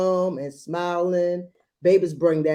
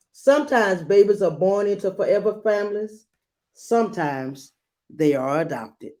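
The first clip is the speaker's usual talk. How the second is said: put-on gentle read-aloud to a child; storybook reading voice; story narration; 2 semitones higher than usual. A narrator's reading. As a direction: read it like a bedtime picture book. Reads picture book aloud.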